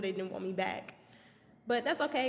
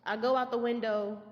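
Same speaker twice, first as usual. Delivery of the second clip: ordinary delivery, nothing marked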